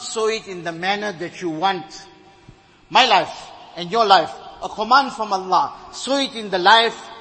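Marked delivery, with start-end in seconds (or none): 2.9-7.1 louder fervent preaching; preaching at full force. Real, not acted. Preacher urges listeners to live by the Prophet's pattern, loud and fervent. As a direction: press the point home to the congregation.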